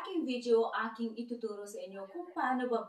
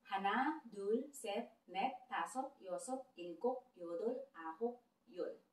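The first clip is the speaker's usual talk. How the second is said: Korean numbers spaced out, each said clearly for learners; slow teaching count; 4 dB quieter than usual. Shown as a demonstration. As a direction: count slowly so students can follow. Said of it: "Kaya dahan-dahan lang natin".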